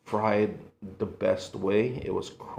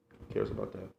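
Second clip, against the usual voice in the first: whispered aside; 3 semitones lower, 10 dB quieter, faster than usual. Acted. Careless kid attitude.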